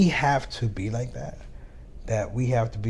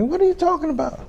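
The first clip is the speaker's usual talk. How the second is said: squeaky baffled dad; 6 dB louder than usual. Retold or imitated. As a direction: voice leaps very high in comic disbelief. Dad's baffled reply, squeaky.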